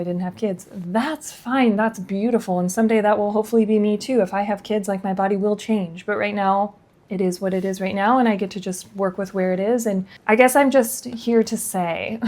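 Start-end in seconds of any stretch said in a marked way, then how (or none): none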